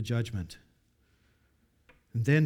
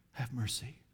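much softer, hollow whisper-like plea; hopeless plea; 3 semitones lower, 10 dB quieter than usual. Acted. Preacher voicing the helpless cry from hell, very soft. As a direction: let the cry fade out helpless. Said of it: "hopeless cry".

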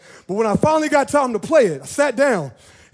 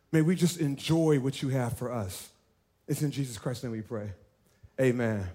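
low, soft, unhurried praying voice; hushed prayer; 8 semitones lower, 8 dB quieter than usual. Acted. Pastor opens the service in a hushed prayer. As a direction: pray quietly over the congregation.

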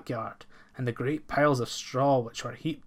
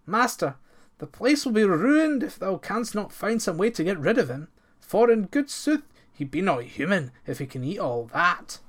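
higher, louder alarmed plea; alarmed servant; 6 semitones higher, 3 dB louder than usual. A narrator's reading. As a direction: plead in alarm. Servants panic about giant.

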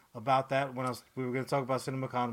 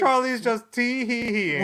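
mock giggle; 9 semitones higher, 9 dB louder than usual. Real, not acted. Teasing co-host's giggle.

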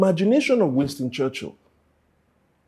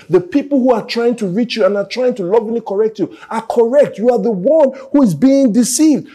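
loud, higher preaching push; full-force preaching; 9 semitones higher, 7 dB louder than usual. Acted. Preacher urging the congregation, loud and higher. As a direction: drive the message home, loud.

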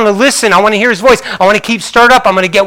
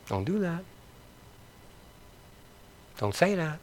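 barely audible, softer still; near-whispered rebuke; 23 dB quieter than usual. Real, not acted. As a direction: let it fade almost to a whisper. Repeated rebuke, dropping off.

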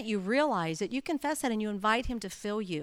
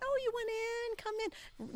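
much higher, soft sing-song welcome; mock sweet nice voice; 11 semitones higher, 11 dB quieter, slower than usual. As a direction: play the overly nice driver, lightly. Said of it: "I'm the nice person going".

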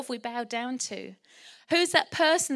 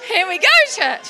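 playful build-up; 6 semitones higher, 13 dB louder than usual. Real, not acted. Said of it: "I think I can do the accents".

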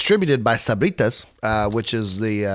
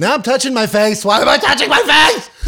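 laughing in panic; 9 semitones higher, 5 dB louder than usual. Real, not acted. Hot sauce near his eyes.

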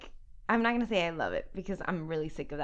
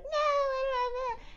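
much higher, slow whining; whiny kid voice; slower than usual. Retold or imitated. Nieces refusing to pick a movie, high whine. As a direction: whine like an indecisive child. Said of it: "they were like".

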